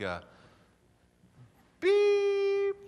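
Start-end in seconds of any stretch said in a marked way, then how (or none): 1.8-2.8 high mock beep tone; answering machine beep. Caller imitating an answering machine beep. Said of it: "it would sound something like".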